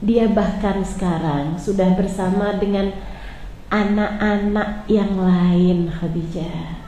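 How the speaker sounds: soft, low, slow comfort; tender reassurance. Acted. Prophet tells grieving Khadijah of paradise, soft and slow. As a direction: reassure her gently.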